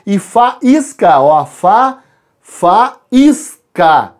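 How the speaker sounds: faísca said slowly as fa-ís-ca, stress on ís; faísca split into three syllables, each one clear. Shown as a demonstration. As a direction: spell out fa, then faísca, slowly for the class. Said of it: "Tenho três sílabas".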